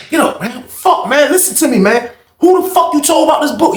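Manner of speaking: high, urgent demand with a shake; demanding who he told about the book, high and urgent; angry demand. Retold or imitated. Confronting the knocked-down man.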